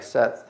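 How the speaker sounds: "saith" said as sed, short e; past-tense short vowel. Shown as a demonstration. Teacher shows the past form, "saith" as sed. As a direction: say "saith" like said, rhyming with bed. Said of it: "you're gonna pronounce it like".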